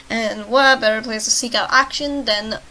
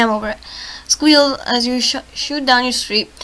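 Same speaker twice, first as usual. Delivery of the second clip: usual speaking voice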